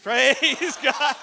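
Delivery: high, loud laugh bursting through. Real, not acted. Pastor cracking up after telling the bridge story, laughing. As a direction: laugh hard at your own story.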